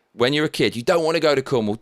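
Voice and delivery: ordinary talk, nothing marked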